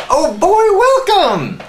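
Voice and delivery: excited welcome. Real, not acted. Greeting the congregation at the start, excited. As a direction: throw the doors open with delight.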